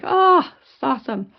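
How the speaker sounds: re-enacted "oh wow" elation; delighted exclamation. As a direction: exclaim it, thrilled. Retold delight at the sighting.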